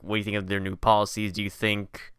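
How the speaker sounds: ordinary talk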